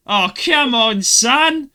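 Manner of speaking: high, loud, exasperated wheedle; mock pestering plea. Essex stranger pestering a passer-by for a lighter, mock exasperated plea. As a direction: badger him loudly, imitating a pushy stranger. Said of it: "Like that".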